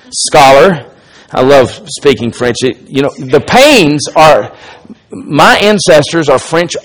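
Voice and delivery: ordinary delivery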